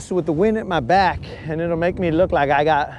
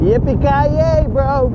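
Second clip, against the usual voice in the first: drawn-out, higher movie hero yell; action hero catchphrase; 8 semitones higher, 5 dB louder, slower than usual. Retold or imitated. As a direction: belt it slowly like a movie hero. Quoting Die Hard catchphrase.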